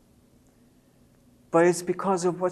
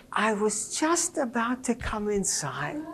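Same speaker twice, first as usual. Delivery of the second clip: put-on small child voice; 5 semitones higher, 4 dB quieter than usual. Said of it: "She says".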